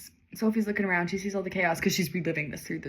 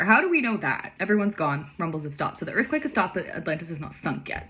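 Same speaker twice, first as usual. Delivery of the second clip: ordinary delivery, nothing marked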